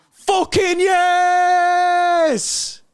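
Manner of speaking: drawn-out joyful roar; long goal yell. Real, not acted. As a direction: stretch it, high and loud.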